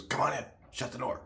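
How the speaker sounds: letting a customer in on the sly, hushed; hushed invitation. Retold or imitated. Barber letting customer in secretly.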